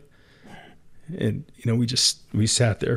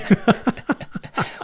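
breathy laugh; 11 semitones higher than usual. Real, not acted. Host dug up old mistake.

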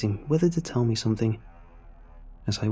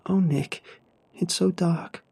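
high, distressed mother's voice; rising pitch; 7 semitones higher, slower than usual. A narrator's reading. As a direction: pitch climbing in mild dismay. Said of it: "the pitch of her voice rising".

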